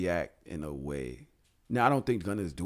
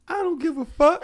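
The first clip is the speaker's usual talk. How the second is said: high, loud mock of her line; hammed-up imitation of a friend's shrug-off; 9 dB louder than usual. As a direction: parrot her defiant line in a squeaky voice. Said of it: "I'm be like".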